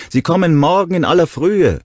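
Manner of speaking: shouted high and loud; calling after. Acted. Will calling after Ronnie, a loud shout. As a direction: call after her as she leaves. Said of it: "rief Will ihr nach".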